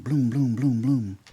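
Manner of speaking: punchy mouth gunshots, bloom bloom; loud spoken gun blasts; mimicked gunshots. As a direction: fire off each shot with your voice. Mimics gunshots during the robbery.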